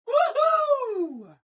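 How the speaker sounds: very high, drawn-out cheer; jubilant whoop. Acted. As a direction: whoop with sheer glee. Celebrates Vegas being energy independent.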